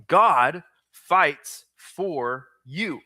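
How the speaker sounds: drawn out very slowly, word by word; very slow repeat. Shown as a demonstration. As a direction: give them time to write. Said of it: "Write this down".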